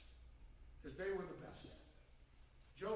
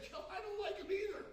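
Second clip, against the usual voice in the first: laughing; 11 semitones higher than usual. Retold or imitated. Retold reply to the boss, laughing.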